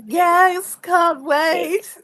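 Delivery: sarcastic high voice. Acted. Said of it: "I'm like".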